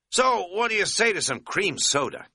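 upbeat offer, lifted pitch; bright. A narrator's reading. Katz cheerfully offering Bryson cream soda, brightly. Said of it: "Katz said brightly".

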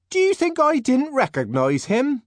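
high, loud, offended question; indignant. Acted. Linda to Bernard about the director, indignant. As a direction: bristle with offence. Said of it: "Linda asked indignantly".

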